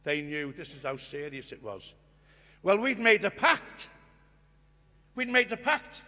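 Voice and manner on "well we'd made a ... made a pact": indignant. Real, not acted. Angry retelling of the broken pact.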